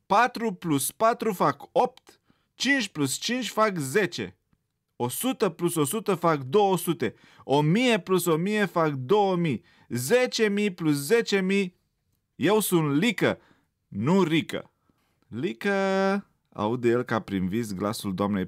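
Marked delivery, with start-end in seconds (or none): 0.1-14.7 loud, much higher, insistent; defiant outburst. A narrator's reading. Rabbit defiantly reciting sums. 15.3-16.2 teacher calling. A narrator's reading. Teacher calls his name.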